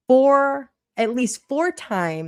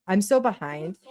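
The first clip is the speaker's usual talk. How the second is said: ordinary delivery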